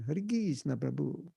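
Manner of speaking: soft Italian-accented greeting, "Krishna" as KEEZ-na; South American Italian lilt, said softly. Shown as a demonstration. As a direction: imitate a Latin devotee's "Hare Krishna, Prabhu". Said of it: "South American Italian".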